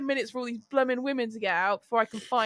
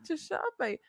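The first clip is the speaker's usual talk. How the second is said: hushed; 4 semitones higher, 8 dB quieter than usual. Retold or imitated. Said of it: "I'm just there like".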